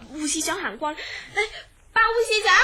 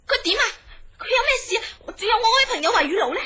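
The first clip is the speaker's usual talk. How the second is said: higher, louder, rushing faster; alarmed questions; 4 semitones higher, 3 dB louder, faster than usual. Acted. Worried woman pressing the head nurse about her friend, alarmed.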